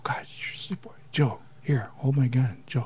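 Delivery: sneaky whisper to a sleepwalker; mock whisper. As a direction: whisper as if not to wake him.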